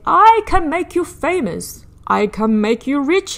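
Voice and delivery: loud, high showman voice of a scheming ringmaster; Sam Sly tempting. Acted. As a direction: sell the dream like a smooth circus boss. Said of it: "he said".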